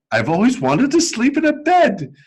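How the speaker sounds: high wondering little bear voice; childlike teddy bear. A narrator's reading. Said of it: "he said".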